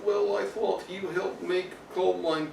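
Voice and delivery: soft mock Russian accent; [name]. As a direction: answer quietly in a put-on Russian accent. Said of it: "will talk with Russian accent".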